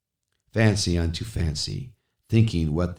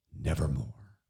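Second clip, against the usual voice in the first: low, much softer, fading out; hushed nevermore; 5 semitones lower, 8 dB quieter than usual. Acted. Narrator sinks into the raven's refrain. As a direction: let the word die away. Raven's word ending the line.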